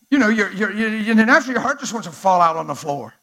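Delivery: loud preacher fervor; passionate preaching. Real, not acted. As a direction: pour your heart into it. Preaching at full force.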